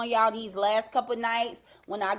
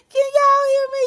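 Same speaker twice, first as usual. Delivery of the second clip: much higher and louder, calling out to the chat; 14 semitones higher, 8 dB louder, faster than usual. Real, not acted. Host calling to live viewers whether they can hear her, loud call-out.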